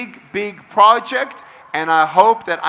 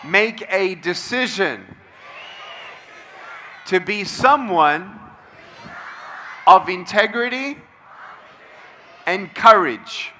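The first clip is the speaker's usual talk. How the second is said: call and response affirmation. Shown as a demonstration. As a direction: lead the room through a pledge, phrase by phrase. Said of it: "Repeat after me".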